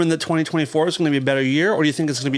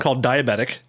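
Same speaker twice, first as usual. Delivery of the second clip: normal talking voice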